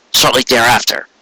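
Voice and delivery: usual speaking voice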